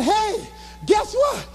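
put-on high, bragging good news to a girlfriend; excited phone call. Excitedly phoning girlfriend after ovation.

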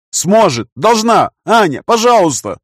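loud, high desperate insistence; frantic, forceful persuasion; urgent pleading. A narrator's reading. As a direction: beg the girl, pushing each short phrase. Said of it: "уговаривал я девочку".

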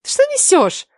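high-pitched indignant hiss; sharp, high scolding hiss. A narrator's reading. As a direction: hiss at your friend while covering the phone. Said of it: "зашипела Лаура".

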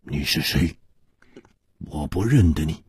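low, hushed and husky; quiet, hoarse denial. A narrator's reading. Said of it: "哑声说".

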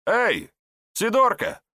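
high, loud holler across a yard; calling out. A narrator's reading. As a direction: hail someone passing by, voice pitched right up. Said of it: "осмотрелся и крикнул". (громкий высокий оклик)

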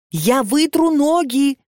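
slow, high-pitched near-shout; nearly shouting. A narrator's reading. Katya to the old caretaker, pleading, nearly shouting. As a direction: Katya at the end of her patience, almost yelling. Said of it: "уже почти кричала Катя".

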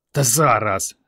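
plain reading voice

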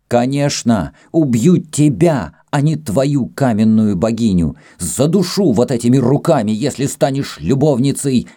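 high-pitched jealous rage; furious threat. A narrator's reading. Jealous Greek threatens to strangle Kama, furious. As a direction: threaten with heated emphasis.

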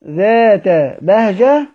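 ذات بهجة drawn out slowly as in recitation; ذات بهجة stretched for the students; slow recitation demo. Shown as a demonstration. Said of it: "يبقى الكسائي يقف على كلمة".

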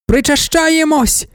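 high, drawn-out crowd chant; women shouting. A narrator's reading. Ecstatic women shout back to their emperor, high and slow. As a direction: cry it out like a fervent crowd. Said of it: "кричали жінки".

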